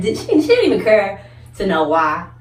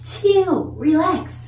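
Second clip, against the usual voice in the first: much higher, airy calming-down voice; mock soothing; 11 semitones higher, slower than usual. Calming her brother at the door, high and airy. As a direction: play it cool and sweet. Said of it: "I'm like".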